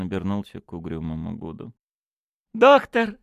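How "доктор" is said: high, loud, delighted call; rapturous cry. A narrator's reading. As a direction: hail the doctor ecstatically. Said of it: "восторженно вскричал он". (восторженный возглас)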